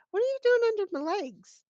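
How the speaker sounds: high, fast startled question; surprised. Real, not acted. Owner startled by dog under her legs, high and quick. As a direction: caught off guard by the dog.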